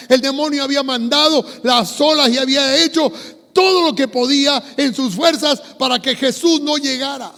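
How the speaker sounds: loud, high-pitched impassioned preaching; preaching at full force. Real, not acted. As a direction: preach it out to the whole congregation at full voice. (predicación a plena voz)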